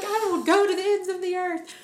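loud, high, laughing vow to God; dramatic pledge. Retold or imitated. Retelling her bargains with God, a loud laughing promise. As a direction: swear it big and laughing at yourself.

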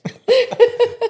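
loud high burst of laughter. Real, not acted. Big laugh.

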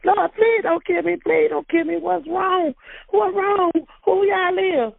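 high, frantic begging; terrified pleading. Retold or imitated. Daughter in bed begging armed officers not to kill her, terrified. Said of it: "I was telling".

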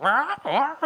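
high cawing crow imitation; crow caws. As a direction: squawk it like a crow. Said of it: "and they're like".

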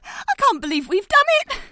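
can't believe it's over; elated relief. Acted. Relief after ordeal.

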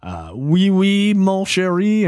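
cheesy fake French accent; bad French accent. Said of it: "That's the worst French accent you've ever heard".

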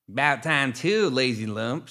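lilting gremlin voice. A narrator's reading. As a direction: jeer it slowly with a lilt. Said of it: "voices were high and lilting".

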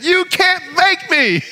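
put-on high, slow childish you can't make me; a kid stubbornly refusing; defiant child. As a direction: mimic a stubborn little kid. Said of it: "little kids can develop that".

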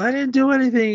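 mock high-pitched plea of innocence; protesting innocence. Slapped boy denies it to Sonny, high mock protest.

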